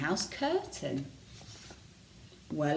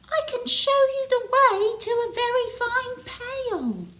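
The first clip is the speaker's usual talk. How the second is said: much higher, tiny snail voice; small snail voice. A narrator's reading. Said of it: "said a small squirrely sail".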